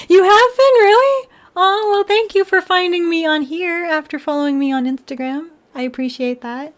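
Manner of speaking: high sugary mock-sincere voice, pitched well up; high put-on chat voice. Thanking a chat follower in a high mock-sweet voice. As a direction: gush in a high mock-grateful voice.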